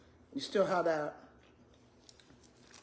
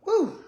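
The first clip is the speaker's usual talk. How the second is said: sharp high whoop; 11 semitones higher, 6 dB louder than usual. Real, not acted. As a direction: let out a loud, high woo. Reacting to hot food.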